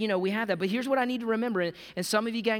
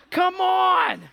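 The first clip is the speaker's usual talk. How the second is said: shouted come on; 10 semitones higher, 8 dB louder than usual. Real, not acted. Preacher's exclamation.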